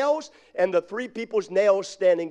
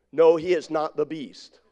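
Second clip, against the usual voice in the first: ordinary talk, nothing marked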